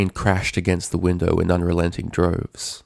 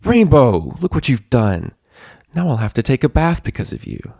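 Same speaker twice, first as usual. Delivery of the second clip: higher, loud scolding snap; enraged Twilight; 6 semitones higher, 6 dB louder than usual. A narrator's reading. Twilight raging at her bloodied captive. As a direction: blame her, furious and cruel. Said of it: "her expression changed to one of rage".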